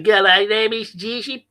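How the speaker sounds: loud, drawn-out Australian caricature; broad Australian accent. Acted. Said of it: "like an Australian accent".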